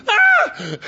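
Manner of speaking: sudden high shrieking cry of pain; goat's scream. Pretend. Goat shrieks as the preacher cuts it open, high scream. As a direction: shriek like the knife just went in.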